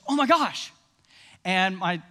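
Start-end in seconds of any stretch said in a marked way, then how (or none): 0.1-0.7 retold shocked exclamation, pitched up; mock a startled little outburst; mild shock. Preacher imitating Katie shocked at one moment, mildly startled. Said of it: "she got shocked".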